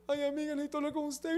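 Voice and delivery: a quick hammed-up plea to a girlfriend; imitating a tearful friend. Woman calling her friend for comfort, mock tearful plea. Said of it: "llaman a una amiga y le dice".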